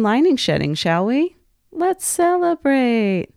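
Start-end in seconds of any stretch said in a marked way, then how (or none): 1.7-3.3 celebratory cheer. Acted. Host cheering on period parties.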